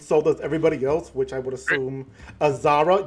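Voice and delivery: ordinary talk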